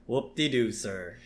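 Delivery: low, soft flat sarcasm; mock unimpressed assistant; a hint of deadpan Smithers; sarcastic. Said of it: "And Smithers says".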